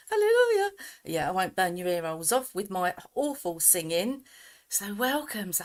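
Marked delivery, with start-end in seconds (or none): 0.1-0.7 high, loud hallelujah; joyful shout. Real, not acted. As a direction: shout it out in triumph. Celebrating that the sound works.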